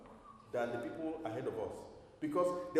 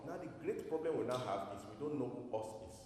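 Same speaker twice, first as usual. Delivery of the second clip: ordinary talk, nothing marked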